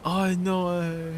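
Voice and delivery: hammed-up Australian "oh no"; playful Australian impersonation; mock Australian accent. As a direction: exaggerate the Australian vowels. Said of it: "I hate it so much 'cause it's so true".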